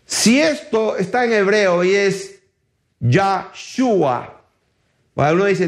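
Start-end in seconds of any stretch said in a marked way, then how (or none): 3.0-4.4 "Yahshua" in two measured parts, Yah then shua; pronounced Yahshua. Shown as a demonstration. Preacher giving the Hebrew name, "Yahshua" with an A. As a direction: say "Yahshua" with YAH as the first syllable. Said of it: "creo que es Yahshua y no Yeshua".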